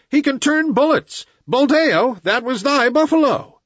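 high, alarmed outcry; crowd shouting. A narrator's reading. Villagers shout in alarm at Buldeo's stray shot. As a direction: cry out high and accusing. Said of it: "shouted the villagers".

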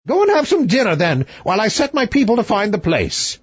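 high, imperious royal voice; haughty king. Acted. King gives orders.

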